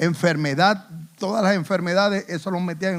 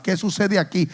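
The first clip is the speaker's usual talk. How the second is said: normal talking voice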